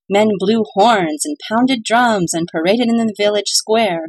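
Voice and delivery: plain reading voice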